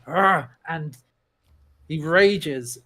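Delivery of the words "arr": short, loud raging growl; imitated growl. Player shows Hargok starting to rage, loud growl.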